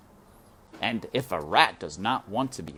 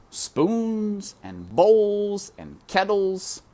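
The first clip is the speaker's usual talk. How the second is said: slow emphatic list; 11 semitones higher, 5 dB louder, much slower than usual. A narrator's reading. Listing outlawed soup tools.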